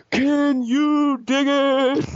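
high, long-drawn-out call; imagined Dusty entrance. Pretend. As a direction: stretch every word out in a high, put-on voice. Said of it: "I can imagine Dusty come out ... He goes".

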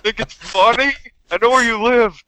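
high, hammed-up menacing voice; mock threat. Pretend chef threatening the prankster, hammed-up and high.

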